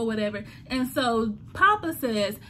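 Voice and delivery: ordinary talk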